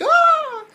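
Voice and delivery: loud high-pitched mock scream; sudden high comic yell. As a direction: imitate a panicked character's shriek. Imitates character rolling on floor.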